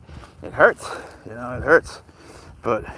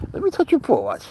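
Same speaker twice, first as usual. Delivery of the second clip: very high cutesy pet voice; voicing the pet; 11 semitones higher than usual. Playing the cat inviting a paw touch, very high and cutesy. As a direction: squeak it out like the cat asking to play. Voicing the pet's bite game.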